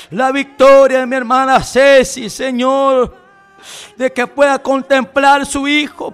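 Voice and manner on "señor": normal talking voice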